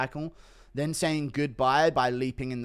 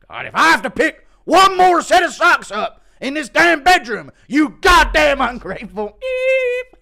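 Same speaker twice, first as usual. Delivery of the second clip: high, loud enraged yelling; furious dad snapping; 13 semitones higher, 15 dB louder than usual. Acted. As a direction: blow up at the kid over socks. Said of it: "impression of Chandler slipping up".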